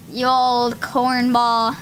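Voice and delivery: slow teasing drawl; slow fond jab at the judge; affectionate tease. Real, not acted. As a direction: stretch it out fondly.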